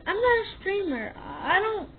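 ordinary talk

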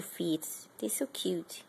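hushed whisper to the pet. Real, not acted. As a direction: breathe it out in a whisper, adoring. Cooing over pet's feet.

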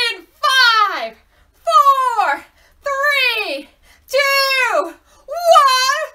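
high, loud, building countdown; launch countdown. Pretend. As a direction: shout it up to a big blast-off. Rocket countdown for children.

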